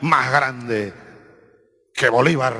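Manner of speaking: ordinary delivery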